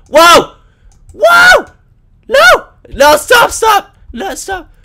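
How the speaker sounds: panicked high yelling; hit hard crying out. Real, not acted. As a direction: scream it as you get hit. Gamer being attacked.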